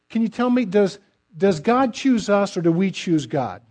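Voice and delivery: ordinary talk, nothing marked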